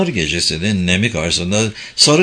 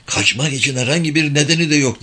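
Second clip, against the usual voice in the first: higher, puzzled character voice; a touch of bewilderment, pitched up; bewildered murmur; 5 semitones higher, faster than usual. A narrator's reading. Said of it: "diye şaşkınlık içinde mırıldandı Hasan". (şaşkınlık içinde, hafifçe tiz)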